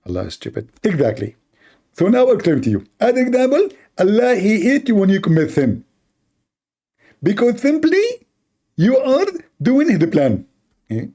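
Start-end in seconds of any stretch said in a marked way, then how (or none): 0.8-10.5 high, mocking preacher voice; Zakir Naik impression. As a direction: parody a lecturing cleric, pitched high. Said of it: "So Zakir".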